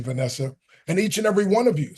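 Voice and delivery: usual speaking voice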